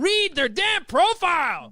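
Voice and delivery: high, loud, drawn-out holler; exasperated shout. Real, not acted. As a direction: yell it out, hammering each word. Exasperated coach shouting his rule.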